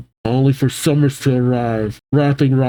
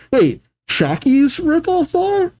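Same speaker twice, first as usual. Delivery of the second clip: surprised realization; 13 semitones higher than usual. Real, not acted. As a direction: squeak it as it clicks. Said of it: "realizes the joke".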